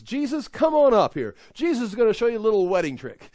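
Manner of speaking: high, loud show-off introduction; hammed-up mom presenting her son's act; proud mother announcing. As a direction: call a performer up to show a trick. Voicing Mary announcing a trick.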